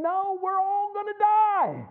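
high, slow mock wail of doom; mock panic. Preacher mocking his own fear at the Red Sea, high slow wail. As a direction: play up the despair with a high, slow voice. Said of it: "And I'm thinking".

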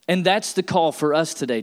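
ordinary talk, nothing marked

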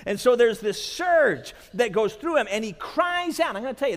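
usual speaking voice

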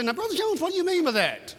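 high voice of a puzzled listener; mock questioner. Asking the preacher, voicing a puzzled congregant. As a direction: play the doubting church member.

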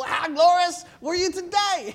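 high sneering wife, laughing; mock him through a laugh; sarcastic. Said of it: "I can just see her roll".

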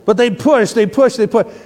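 loud, high, pressing on each push; rising pressure in the voice; emphatic push. Shown as a demonstration. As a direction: lean hard into every push. Illustrating pressure building up.

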